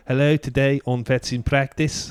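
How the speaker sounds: slow South African TV-presenter greeting; South African accent, rising at the end; Tudor Moss Stew. Acted. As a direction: imitate a South African host opening a show. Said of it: "She used to do".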